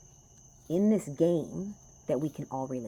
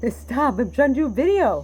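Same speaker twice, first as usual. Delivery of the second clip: loud, high laughing protest; telling someone off; 11 semitones higher, 14 dB louder than usual. Real, not acted. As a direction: push them away while laughing, much louder. Laughing scolding partner off camera.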